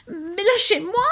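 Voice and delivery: put-on high, loud child's frightened cry; a scared little boy begging to be let go. A narrator's reading. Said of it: "cria-t-il".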